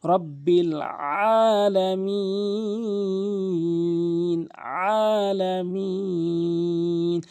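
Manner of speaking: "আলামিন" very long with mid-throat ain; pressed throaty AIN. Shown as a demonstration. Teacher repeats the correct form, "আলামিন" chanted with ain. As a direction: chant "আলামিন" slowly, pressing the first sound. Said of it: "চাপ দিয়ে উচ্চারণ করতে হবে".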